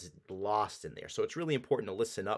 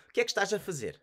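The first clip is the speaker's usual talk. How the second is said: quick, syllables swallowed, pitched higher; native speed; 9 semitones higher, much faster than usual. Shown as a demonstration. Teacher showing the authentic native version, quick and run together. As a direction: say it like a local would. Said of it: "as authentically and genuinely as possible".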